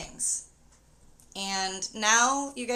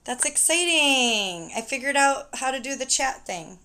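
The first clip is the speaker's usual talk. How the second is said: excited; 3 semitones higher, 7 dB louder than usual. Real, not acted. Delight at figuring out chat.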